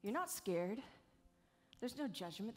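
usual speaking voice

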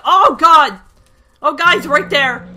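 shrill, loud terrified yells; frantic shouted panic; panicked screaming. Real, not acted. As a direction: scream in real panic as the ghast attacks. Scream spotting ghast.

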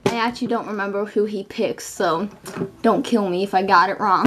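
normal talking voice

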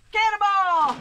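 loud jump call; cannonball yell. Real, not acted. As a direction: shout it as you leap. Jumping into pool countdown.

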